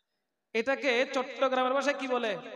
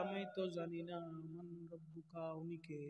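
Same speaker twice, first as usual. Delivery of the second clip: much softer, lower, helpless mumble; confused failing soul; 7 semitones lower, 17 dB quieter than usual. Acted. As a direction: play a lost soul who can't answer. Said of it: "যদি বলা হয়". (হতবুদ্ধি কবরবাসী, নরম নিচু গলায়)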